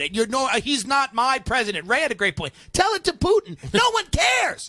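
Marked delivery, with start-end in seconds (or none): 2.7-4.7 mock retort. Mocking retort, high loud.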